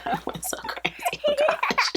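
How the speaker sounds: squeaky laugh breaking through the words; laughing at her own shopping spree. Real, not acted. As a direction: giggle it out, caught in laughter.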